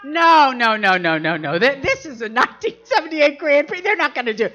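loud, high rapid-fire no-no-no; hammed-up indignant reassurance; insistent denial. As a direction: wave off the danger with loud protest. Acted date dismissing the danger.

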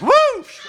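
a big high whooshing swoosh; whoosh sound effect. Retold or imitated. Comic telling the crowd how the dress flew off, loud high whoosh.

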